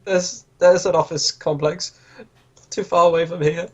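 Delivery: high, hushed stammer; intimidated captive. Acted. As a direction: give up the secret in a high character voice.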